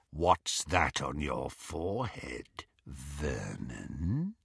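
low and soft, very quiet; soft menace. A narrator's reading. Greyback to Harry up close, soft and low. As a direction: ask it gently like a threat. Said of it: "he asked softly".

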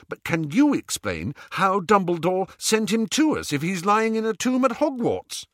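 high-pitched, pressing counter-question; insistent retort. Acted. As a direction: push back with a high, urgent question. Retorts in an argument.

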